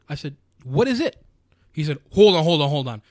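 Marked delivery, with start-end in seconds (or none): 0.6-1.2 higher and louder, demanding a straight answer; mock exasperated yell. To the hearing officer, demanding an answer in a raised voice. 2.1-3.0 louder, cutting someone off hard; imitating a shout. Hearing officer cutting off the complainer with a loud hold on.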